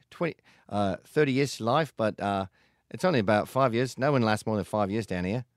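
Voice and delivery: loud, cheery Australian accent, badly done. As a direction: grin through a rough Aussie accent as a doomed prisoner. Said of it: "That's not a great Australian accent".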